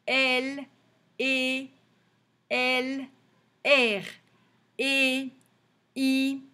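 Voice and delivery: one letter at a time, pauses between; even, level recital, each letter held briefly; measured teacher's letter drill, same tone on every one; reading out letters. Shown as a demonstration. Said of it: "on va lire les lettres".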